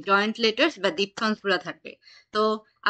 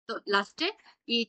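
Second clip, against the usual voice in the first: normal talking voice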